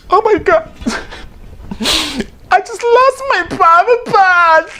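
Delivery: very high, hammed-up cry of dismay; shocked squeal. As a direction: squeal it like you just lost everything. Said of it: "You're like".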